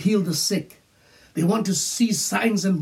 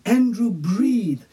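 slow call, a touch louder; nurse calling; 6 semitones higher, 4 dB louder, slower than usual. Retold or imitated. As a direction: draw out the name, urging. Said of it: "She'd call out again".

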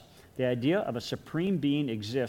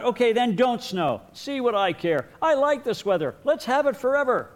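high, loud, pouty fake indifference; sulky kid to God; 10 semitones higher, 6 dB louder than usual. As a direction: pretend you don't care, huffily. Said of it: "And then speaking to God".

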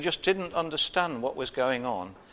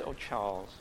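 much softer, lower, fond "Oh, Charles,"; mock tender wife; 5 semitones lower, 10 dB quieter than usual. Wife speaking fondly to her husband, mock tender. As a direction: coo it like an affectionate wife. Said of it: "And if she says".

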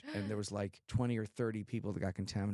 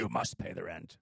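put-on low, sneering old-time radio villain; radio drama villain; 5 semitones lower than usual. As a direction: growl the demand like a panto baddie. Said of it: "They had actors be like".

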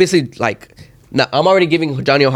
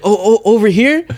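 high, stammered anxious question; imitated freaking out; 9 semitones higher, 4 dB louder than usual. Nervous driver asking officers where to go, freaking out. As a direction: imitate a flustered driver, pitched way up. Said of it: "he's, like, freaking out".

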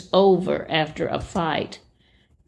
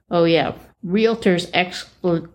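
normal talking voice

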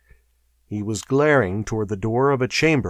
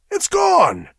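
very high-pitched cry; astonished cry; 4 dB louder than usual. A narrator's reading. Guard staring at the empty pillar, crying out. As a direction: cry out at the discovery. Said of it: "he cried".

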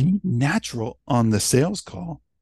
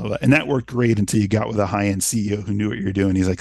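usual speaking voice

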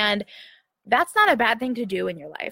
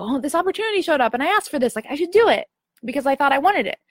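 higher-pitched, eager past self; mildly acted eagerness; 6 semitones higher, 3 dB louder than usual. Past self reacting to an opportunity, mildly eager and higher. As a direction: lightly play up talking yourself into it.

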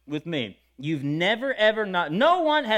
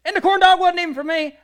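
usual speaking voice

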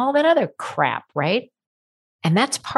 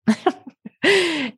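a high, bright giggle at her own joke; light laugh; 11 semitones higher than usual. Real, not acted. Host laughing at her own chocolate joke, a high giggle.

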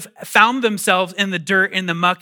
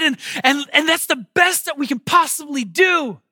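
anxious Mary; 11 semitones higher, 6 dB louder than usual. Retold or imitated. Mary worrying she has nothing for Jesus, high anxious voice. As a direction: fret high and pleading over the baby.

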